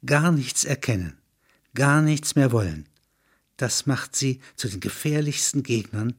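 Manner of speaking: ordinary delivery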